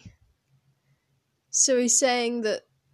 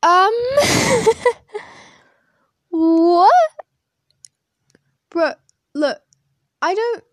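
shocked; 9 semitones higher, 13 dB louder than usual. Real, not acted. Reacting to offensive quiz statement.

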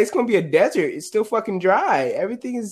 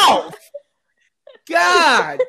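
high, loud hammed-up exasperation; mock scolding shout; 11 semitones higher, 6 dB louder than usual. Co-host scolding the other for cursing, mock shouting. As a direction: cry out in pretend outrage.

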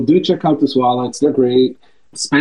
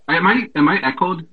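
muffled; 4 semitones higher than usual. Real, not acted. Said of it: "You just sound like you're in a tunnel".

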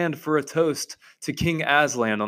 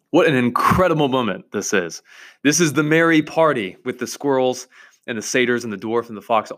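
ordinary talk, nothing marked

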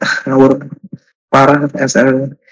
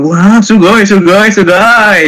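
slow, drawn-out "sugoi" like an anime fan; excited anime fan; 4 semitones higher, much slower than usual. Retold or imitated. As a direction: gush in awe like a starstruck otaku. Mimicking Japanese fan reaction.

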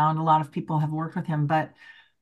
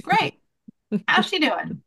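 much higher, breezy fake cheer; mock cheerful; 8 semitones higher than usual. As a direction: play along as if grandma is alive. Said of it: "I was like".

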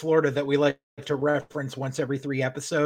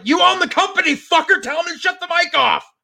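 much higher, louder fed-up outburst; real exasperation; exasperated rant; 11 semitones higher, 5 dB louder than usual. Real, not acted. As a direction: vent your disbelief at the screen. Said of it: "And it's like".

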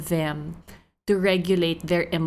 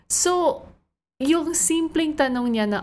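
normal talking voice

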